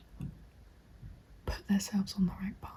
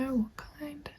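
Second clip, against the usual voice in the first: ordinary talk, nothing marked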